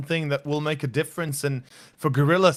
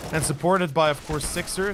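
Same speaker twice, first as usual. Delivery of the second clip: normal talking voice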